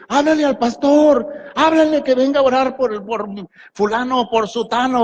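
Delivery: mock a member begging for the pastor; lightly voiced pleading churchgoer; imitating churchgoers. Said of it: "es más fácil para la gente decir".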